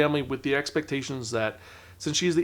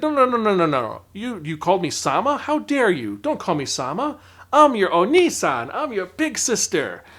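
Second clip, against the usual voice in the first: louder, higher, mock outraged protest; no-no-no burst, hammed-up indignation; indignant big sister; 5 semitones higher, 6 dB louder than usual. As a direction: play the affronted big sister insisting on her title. Sister protests the Sama title.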